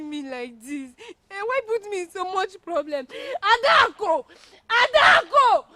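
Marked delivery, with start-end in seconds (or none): 1.3-3.1 loud, raised exasperated complaint; high, forceful reproach; upset outburst. Acted. 3.1-5.6 full-voiced high shout; long, loud cry after someone; frantic calling.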